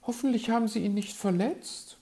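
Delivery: high, quick worried question; piping, doubtful; sceptical magpie. A narrator's reading. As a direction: ask it doubtfully in a high bird-lady voice. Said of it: "war die Elster skeptisch".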